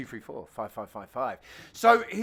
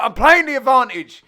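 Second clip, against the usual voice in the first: imitating Dave; 6 semitones higher, 12 dB louder than usual. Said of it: "And he's like".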